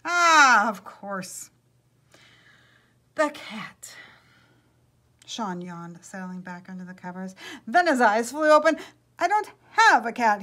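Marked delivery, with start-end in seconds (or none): 0.0-3.9 loud, drawn-out "ah" of understanding; sudden realisation. A narrator's reading. Sleeper's sudden realisation, acted. 7.7-10.4 loud, high jolt of alarm; dramatic storybook surprise; startled realisation. A narrator's reading. Sean startled awake, acted by narrator.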